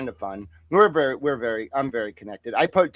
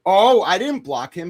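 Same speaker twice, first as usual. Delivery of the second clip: a higher, louder oh of discovery; surprised realization; 5 semitones higher, 5 dB louder than usual. Real, not acted. Finding the account restricted, surprised and relieved. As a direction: it dawns on you.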